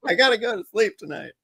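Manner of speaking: laughing. Real, not acted. Laughing about caffeine-free Coke.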